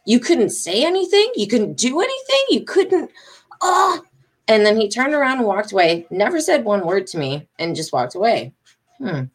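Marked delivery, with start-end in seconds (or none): 0.0-4.0 rising higher with each outraged question; mock confront him, pitch climbing; retold disbelief, higher; incredulous. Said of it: "it wasn't until I started, like".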